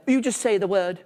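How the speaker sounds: lightly mocking father voice; dad impression. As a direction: mimic a dad talking to the camera. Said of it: "he's like".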